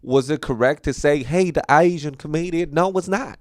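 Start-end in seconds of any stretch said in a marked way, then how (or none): 1.2-2.7 higher-pitched mock Southern twang; bad Southern accent. Quoting the Southern fan's greeting, in a mock Southern accent. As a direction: briefly slip into a fake Southern accent. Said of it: "Southern accent".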